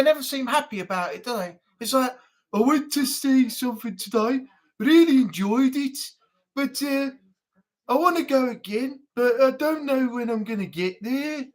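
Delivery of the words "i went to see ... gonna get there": mock unenthusiastic Brummie; Birmingham accent. Streamer imitating someone who really enjoyed it, joyless Birmingham accent. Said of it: "not be more enthusiastic with a Birmingham accent".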